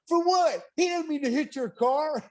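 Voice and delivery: very high, protesting mock-wife voice; hammed-up falsetto wail of protest; imitated ex-wife. Ex-wife defending the deer to her husband, imitated in a high protesting voice. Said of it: "And she was like".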